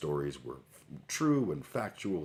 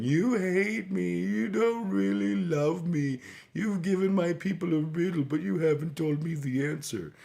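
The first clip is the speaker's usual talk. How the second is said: mock sobbing; 13 semitones higher, 7 dB louder than usual. A narrator's reading. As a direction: play the wife pleading through fake tears, much higher.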